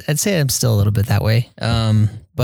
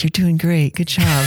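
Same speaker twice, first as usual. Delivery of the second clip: put-on high, sweet, encouraging voice like talking to a child; patronising praise; 7 semitones higher than usual. Investigator praising a missionary's bad Portuguese, high and patronising. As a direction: pat the new guy on the head with your voice. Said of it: "the investigator looks at me smiling, like".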